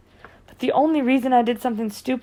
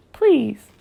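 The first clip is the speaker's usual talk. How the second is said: voice breaking plea; 3 semitones higher, 4 dB louder than usual. A narrator's reading. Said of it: "The words began to break up in my throat".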